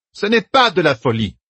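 a high, imperious denial. Acted. Saérine cutting in on Egwene, imperious. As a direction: overrule her firmly. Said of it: "d'un ton plus impérieux".

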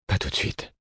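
breathed, unhurried refusal; soft whisper. Acted. Lover whispers to her, not wanting to leave. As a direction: whisper it to keep her close.

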